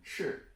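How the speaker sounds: "斥" with a falling tone; fourth-tone demo. Shown as a demonstration. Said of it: "And finally".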